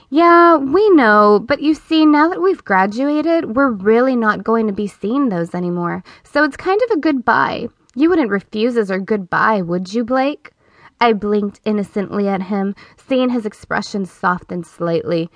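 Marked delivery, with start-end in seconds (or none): 0.1-10.5 coy flirting. Acted. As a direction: sweet-talk him, wide-eyed and innocent. Flirting to persuade teacher.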